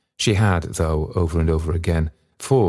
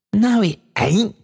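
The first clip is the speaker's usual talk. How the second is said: high, drawn-out gleeful cry; burst of delight; 4 dB louder, slower than usual. A narrator's reading. Daughter thrilled at father's wrong guess, delighted cry. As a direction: squeal the denial with delight. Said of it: "cried Meg in a burst of delight".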